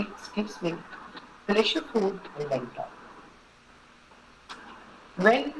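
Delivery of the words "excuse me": muffled, much softer excuse me; behind a covered mouth. Shown as a demonstration. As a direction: say it quietly through your hand. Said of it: "So cover your mouth and say".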